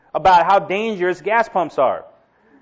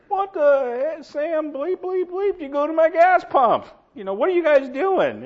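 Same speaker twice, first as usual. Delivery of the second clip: mock irate screaming; 10 semitones higher than usual. Attendant to the men laughing at him, mock irate. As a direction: imitate an upset attendant in a high voice. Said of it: "he comes screaming out of the gas thing".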